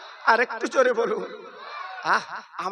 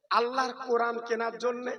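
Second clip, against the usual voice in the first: usual speaking voice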